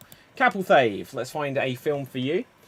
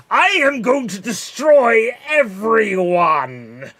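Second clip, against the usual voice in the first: loud, slow, higher villain shout; villain's boast; 7 semitones higher, 9 dB louder, slower than usual. Acted. Evil dictator threatening the whole world, booming slow shout. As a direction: bellow the threat like a cartoon tyrant. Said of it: "let's see what Bison's doing".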